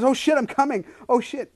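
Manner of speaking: reenacted startled outburst, high and loud; retold sudden surprise. Retelling to listeners what just happened, mock startled cry. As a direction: act out being caught off guard, loud and high. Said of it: "that was what just happened".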